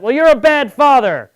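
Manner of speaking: loud, high comeback to a heckle; shouted retort. Retold or imitated. Comedian answering a heckler on stage, re-enacted high loud retort. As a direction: fire it back at yelling volume.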